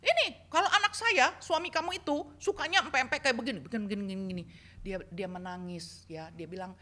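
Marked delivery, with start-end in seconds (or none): 0.0-4.4 high, fast, lecturing; bossy mother-in-law. Retold or imitated. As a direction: lay down the rules about her son.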